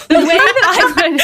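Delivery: laughing hard; helpless laughter. Real, not acted. Laughing after reading.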